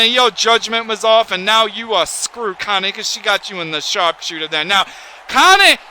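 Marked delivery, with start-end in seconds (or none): 5.3-5.8 much higher, louder sudden call of the name; excited yelp. Real, not acted. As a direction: blurt the name out in alarm. Sudden call during match.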